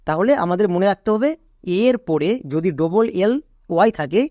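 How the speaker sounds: ordinary talk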